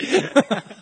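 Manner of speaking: reliving the joke, giggling; laughing. Real, not acted. Still laughing at story.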